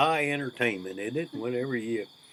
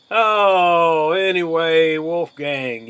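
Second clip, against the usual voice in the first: excited; 6 semitones higher, 11 dB louder, much slower than usual. Real, not acted. Excited greeting to Wolfgang.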